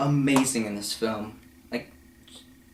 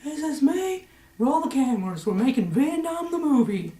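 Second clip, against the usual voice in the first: very high, louder squawky put-on voice; mock bird voice; 3 dB louder than usual. Birds on the boat heard as a silly high squawk. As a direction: squeal it like a cartoon bird. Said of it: "You could just hear".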